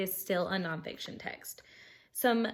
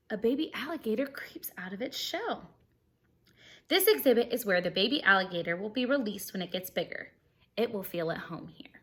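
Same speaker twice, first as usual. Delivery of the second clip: bright, lively storybook reading; animated read-aloud for kids; reading aloud to children; 5 dB louder than usual. Acted. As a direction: read it like story time for a class. Reading picture book to class.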